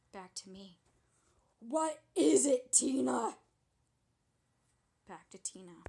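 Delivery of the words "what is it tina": much louder, slower shout; loud demand. Acted. The friend demanding that Tina finish the story, shouting. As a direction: yell it out, impatient.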